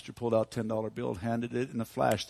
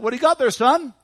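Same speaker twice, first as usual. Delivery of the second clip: raised high, loud friendly hail; calling to a boy; 13 semitones higher, 13 dB louder than usual. Retold or imitated. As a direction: pitch it up and call out to the lad. Pastor calls to passing boy.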